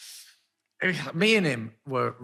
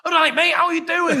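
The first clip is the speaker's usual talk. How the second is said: high, loud laddish mate voice through a laugh; chirpy impression of a friendly bloke; imitating Will; 13 semitones higher, 8 dB louder than usual. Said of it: "He was like".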